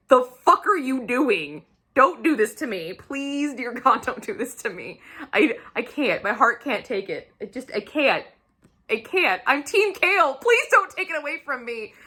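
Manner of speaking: mock-anguished whine; desperate pleading. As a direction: plead with the author like a heartbroken fan.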